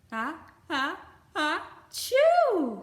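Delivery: building sneeze, high and very loud at the burst; big sneeze. Acted. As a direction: wind up and explode into a sneeze. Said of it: "sneezed Baby Bear".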